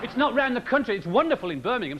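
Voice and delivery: usual speaking voice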